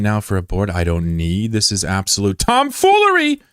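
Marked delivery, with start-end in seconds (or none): 1.5-3.4 high, hammed-up exasperation; mock outrage. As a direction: voice shooting up high in indignation.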